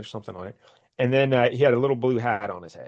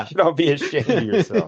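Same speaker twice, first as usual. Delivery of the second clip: higher-pitched scolding quote; Norm Macdonald; 5 semitones higher, 4 dB louder than usual. Acted. Co-host quoting a comedian's line, playful mock scolding. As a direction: scold the crowd in a lightly imitated comedian's voice. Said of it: "a couple Norm Macdonald references there".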